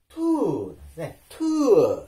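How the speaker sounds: トゥー with a puff of air after the T; delay the vowel in トゥー with a breath; breathy-released T in トゥー, falling tone; aspirated. Shown as a demonstration. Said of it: "間があったらどうなるかというと".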